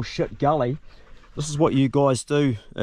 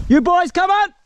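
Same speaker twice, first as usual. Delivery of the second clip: long high shout to mates far off; calling out; 7 dB louder than usual. Real, not acted. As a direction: call loud across the gully. Calling to distant hunting mates.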